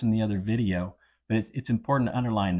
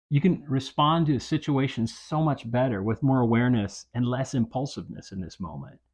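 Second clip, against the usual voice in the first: ordinary talk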